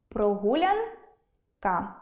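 прогулянка broken as прогулян — ка; прогулянка split before the final syllable; split for line break. Shown as a demonstration. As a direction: draw out прогулян upward, pause, drop on ка. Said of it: "як воно ділиться на склади".

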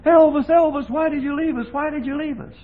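high wailing cry of grieving fans; mock hysterical scream. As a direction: imitate the wailing women, loud and high. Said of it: "Women screaming hysterically".

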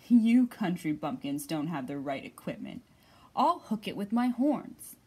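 loud, low, smug boast; smug ram. Acted. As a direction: brag like a cocky ram. Ram boasts to country animals.

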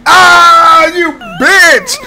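loud furious shout at the game; angry yell. Real, not acted. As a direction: yell it out as you lose. Game death, yelling at enemy.

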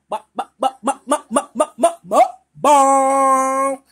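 stuttered build to a loud reveal; hyped-up announcement. Acted. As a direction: build it up then shout mukbang.

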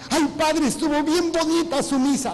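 voz más aguda y fuerte, imitando a una feligresa encantada; feligresa entusiasta. Retold or imitated. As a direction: exagera el entusiasmo de una feligresa al salir de misa. (feligresa imitada por el padre, entusiasmo exagerado)

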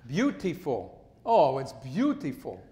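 "beautiful" drawn out in a broad Philly accent. Shown as a demonstration. Showing the host his Philly "beautiful" with a laugh. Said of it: "what is it?".

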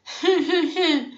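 high, hammed-up sobs; acted boo-hoo after a wail; continual crying. A narrator's reading. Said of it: "έλεγε και έκλαιγε συνέχεια". (ψεύτικο κλάμα με λυγμούς)

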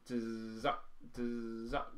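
short buzzed roll imitation; vocalised drum roll. Shown as a demonstration. Drum teacher showing a nine stroke roll, vocalised drum roll. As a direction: buzz into a quick stop, like a snare roll.

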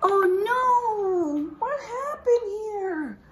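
high gasp then slow alarmed cry; gasped shock. Acted. Teacher gasping at the page for the class, high and alarmed.

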